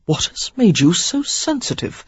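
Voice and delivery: ordinary delivery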